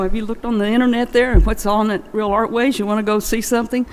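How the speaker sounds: ordinary delivery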